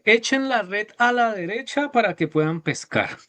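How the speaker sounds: high, calm call from shore; Jesus instructing. Acted. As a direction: gently tell them where to cast. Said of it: "Jesús desde la orilla les dice".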